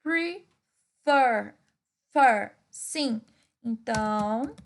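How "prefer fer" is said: "Prefer." then "Fer." said louder on its own; "Fer." pulled out of "Prefer." and hit hard; stress on fer. Shown as a demonstration. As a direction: isolate and punch the syllable "Fer.". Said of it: "a última sílaba é tônica?".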